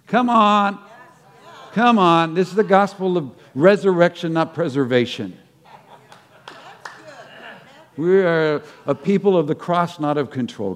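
loud, high preaching cry; preaching shout. Real, not acted. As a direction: lift the crowd with fervour. Preaching climax.

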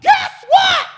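very high, loud, excited preaching cry; fiery preacher shout. Retold or imitated. Delynn preaching to the church, a high fiery shout. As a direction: shout it up high over the congregation. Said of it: "He's like".

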